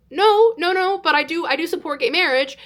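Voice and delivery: high, backpedalling protest; retold awkward, high-pitched defence; nervous denial. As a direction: deny hurriedly, then timidly hold your ground. Nervously denying to leader, then admitting.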